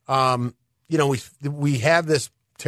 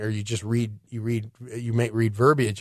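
ordinary talk, nothing marked

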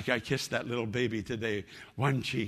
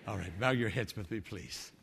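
prayer; 6 dB quieter than usual. Acted. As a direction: pray softly over music. Leads a prayer.

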